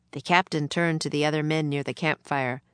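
plain reading voice

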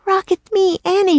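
put-on high, urgent girl's voice; high-pitched young heroine, insistent; Annie pleading. A narrator's reading. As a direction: call up to a friend in a high, earnest voice. Annie calling to Rock.

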